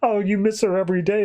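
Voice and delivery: high, fast, hammed-up weepy echo. Alien moved by sheriff's story, mock tearful. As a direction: gush it back with mock tears welling. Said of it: "starts, like, tearing up".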